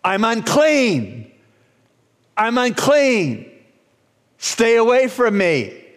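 drawn-out high cry of warning; yelled warning. Acted. Leper to an approaching healthy person, yelling a warning. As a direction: call out slowly to keep people back. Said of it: "said you had to yell out".

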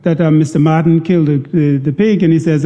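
ordinary delivery

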